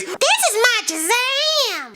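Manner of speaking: high, slow stretched yell; hammed-up high drawn-out call; mock Dave catchphrase. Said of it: "says that signature catchphrase".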